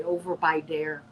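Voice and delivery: "over by dare" with a hard d for th; "dare" starting on a plain d; Chicago phrase. Shown as a demonstration. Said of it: "We have phrases in Chicago".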